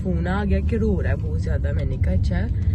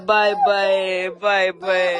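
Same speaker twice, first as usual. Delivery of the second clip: mimicking child; 5 dB louder, much slower than usual. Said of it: "Shabir शुरू कर देता है".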